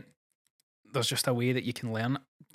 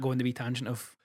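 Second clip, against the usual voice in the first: normal talking voice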